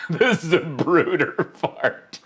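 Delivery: slow, helpless laughing through the words; laughing hard. Real, not acted. Streamer cracking up at a clip, laughing hard. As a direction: squeeze it out through real laughter. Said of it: "laughing so hard I'm crying".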